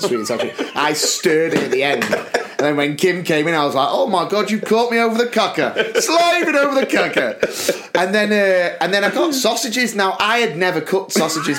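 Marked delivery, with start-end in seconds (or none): 3.9-7.9 high, hammed-up flustered cook; put-on high-pitched fluster, building higher; feigned surprise. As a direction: pretend you were caught slaving away. Pretends to have slaved at cooker.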